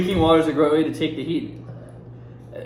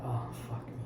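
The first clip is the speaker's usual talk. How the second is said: whispered curse; 14 dB quieter than usual. Real, not acted. Hot pepper jelly bean burns.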